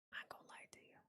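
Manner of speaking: barely audible whisper; whispered aside. Real, not acted. Streamer admitting a dislike to viewers, whispered. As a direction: drop to a tiny confiding whisper. Confides dislike of loud audio.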